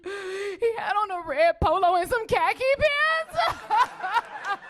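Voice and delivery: high, drawn-out mock sobbing; hysterical wail. Hysterical owner describing lost dog to neighbor, exaggerated wail. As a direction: wail it out through hammed-up tears. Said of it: "She was hysterical".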